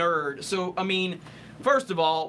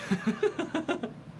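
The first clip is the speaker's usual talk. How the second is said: high laugh; 9 semitones higher, 5 dB quieter than usual. Real, not acted. Laughing at super chat.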